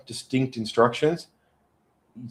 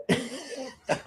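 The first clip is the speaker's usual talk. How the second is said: high airy laugh; burst of laughter; 13 semitones higher, 9 dB quieter than usual. Real, not acted. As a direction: break into laughter. Laughs at her story.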